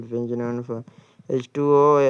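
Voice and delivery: ordinary delivery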